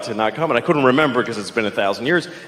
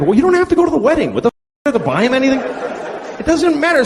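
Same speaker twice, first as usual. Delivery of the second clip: fast rant; 11 semitones higher than usual. Acted. Ranting back at objectors.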